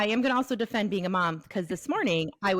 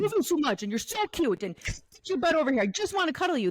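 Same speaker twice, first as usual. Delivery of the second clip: higher, mock-scolding loving words; harsh voice; 6 semitones higher than usual. Shown as a demonstration. Showing how tone scares a dog, harsh. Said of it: "in a harsh voice".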